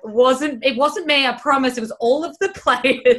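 loud, high-pitched mock protest; embarrassed insistence. Team manager mum denying favouritism to parents, loud embarrassed protest. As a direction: imitate a flustered mum protesting her innocence. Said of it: "she used to be embarrassed".

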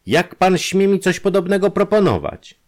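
high, loud indignant shout; outraged. A narrator's reading. Industrialist rebuking the detective's offer, indignant shout. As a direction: hurl the rebuke at him. Outraged reply to indecent proposal.

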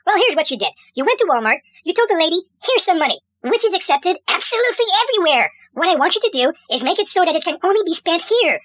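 ordinary talk, nothing marked

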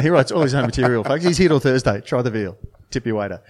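mock comedy club host. Teasing his co-host like a cheesy club MC, loud and quick. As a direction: sell it like a cheesy club MC wrapping a set.

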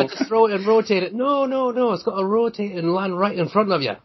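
high-pitched, hammed-up Scottish accent; bad Scottish accent. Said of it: "forgive me for my horrendous Scottish accent".

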